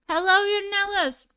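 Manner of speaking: a high, bright burst of a greeting; sudden yell. Real, not acted. Greeting a viewer on her live, sudden yell. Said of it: "I don't know why I just yelled that".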